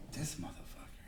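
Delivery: voiceless whisper under the breath; barely audible stunned mutter; whispered aside. Retold or imitated. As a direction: whisper it to yourself in disbelief. Muttered aside while sitting in meeting.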